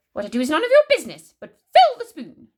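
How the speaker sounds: high, loud, imperious grandmother; cranky Grandma, snappish. A narrator's reading. Grandma rebukes George over the spoon, high and snappish. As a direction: put him in his place, loud and high.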